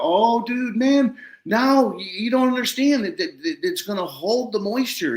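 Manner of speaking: high-pitched, gushing explanation; retold excitement. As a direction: act out thrilled reassurance. Retold excited explanation to confused farmer.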